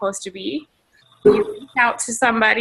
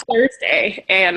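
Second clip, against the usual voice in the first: ordinary talk, nothing marked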